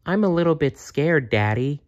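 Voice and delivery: put-on a young girl admitting fear; little girl. Jenny tells her father.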